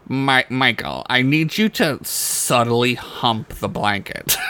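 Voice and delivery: usual speaking voice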